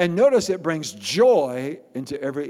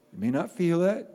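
ordinary talk

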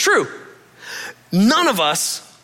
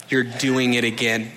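lower, softer pointed reminder; mildly reproachful; 7 semitones lower, 4 dB quieter than usual. Retold or imitated. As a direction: quietly call him out, low and flat. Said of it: "It was like".

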